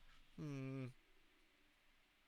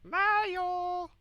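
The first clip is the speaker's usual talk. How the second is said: Luigi. Acted. Imitates Luigi calling out in game.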